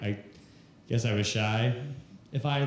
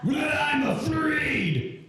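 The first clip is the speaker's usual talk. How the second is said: high, drawn-out shouted wail; stand-in impression voice; 14 semitones higher, much slower than usual. Texting an ex's panicked message to the audience, high drawn-out wailing voice. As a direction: stretch it into a very high, slow cry. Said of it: "so that's the voice".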